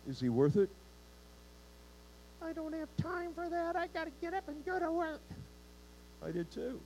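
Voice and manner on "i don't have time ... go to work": high, quick whiny excuse voice; squeaky put-upon excuse; mock excuse. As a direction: mimic a lazy churchgoer brushing it off. Mocking excuse of busy listener.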